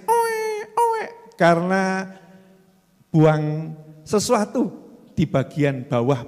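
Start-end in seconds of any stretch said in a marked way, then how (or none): 0.0-1.0 put-on high wailing baby cry oe oe; imitated baby crying. Preacher mimics the crying baby, high wail. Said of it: "Anaknya nangis".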